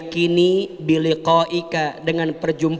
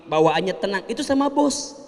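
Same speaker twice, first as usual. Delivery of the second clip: usual speaking voice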